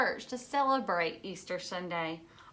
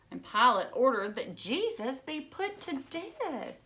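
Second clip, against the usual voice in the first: usual speaking voice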